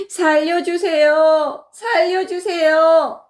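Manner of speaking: high, drawn-out wailing plea; crying out for help. Acted. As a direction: keep calling out to be rescued. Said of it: "계속 소리를 질렀지요".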